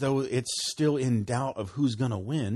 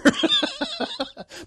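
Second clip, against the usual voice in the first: high laugh; 3 dB louder than usual. Real, not acted. Laughs at his own joke.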